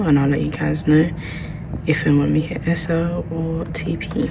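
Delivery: hushed whisper. Real, not acted. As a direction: keep it to a whisper. Continues whispering in library.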